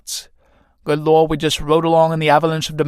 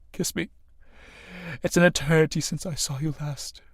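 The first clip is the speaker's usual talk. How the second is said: tender plea; 7 dB quieter than usual. Acted. Harry pleading with Helena, lovers.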